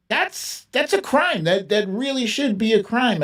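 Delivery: incredulous, voice jumping up; outraged disbelief. Real, not acted. Reading a viewer's EpiPen price, shocked and indignant.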